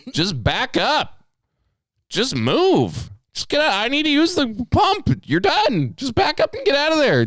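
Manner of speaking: mock a shrill plea to just back up; high mocking whine at the parked driver; mock whiny high-pitched rant. Angry rant at driver in car.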